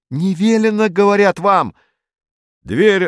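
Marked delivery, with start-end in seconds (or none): 0.1-1.7 high, loud protesting yell; indignant cries. A narrator's reading. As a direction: cry out in boyish indignation. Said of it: "негодующие крики Уна". (высокий негодующий крик)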